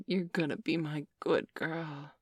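ordinary talk, nothing marked

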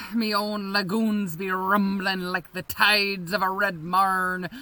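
ordinary delivery

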